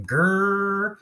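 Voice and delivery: high, loud, stretched "Gr"; first sound isolated. Shown as a demonstration. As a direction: hold "Gr" out alone as "grrr". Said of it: "What do we hear first?".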